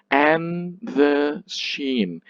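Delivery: slow, equal weight on AND, THE, SHEEN; stress on every word. Shown as a demonstration. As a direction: stretch "and" and "the" as fully as "sheen". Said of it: "You're putting the stress wrong".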